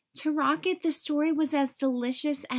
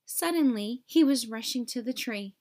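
ordinary delivery, nothing marked

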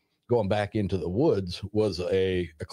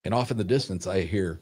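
ordinary delivery

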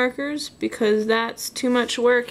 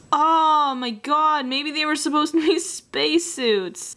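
amazed realisation; 6 semitones higher than usual. Real, not acted. Artist suddenly understanding her childhood drawing, amused astonishment. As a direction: let it dawn on you, pitch leaping up.

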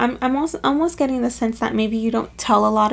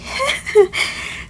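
embarrassed laugh; 11 semitones higher than usual. Real, not acted. Embarrassed laugh after outburst.

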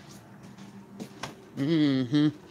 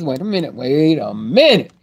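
ordinary talk, nothing marked

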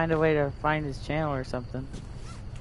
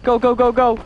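being chased; 9 semitones higher, 4 dB louder, much slower than usual. Real, not acted. Chased, shouting while fleeing.

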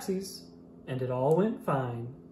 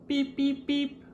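squeaky toy-truck beep, much higher; high beeping horn; 11 semitones higher than usual. Acted. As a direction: chirp it like a little horn. Truck's horn in picture book.

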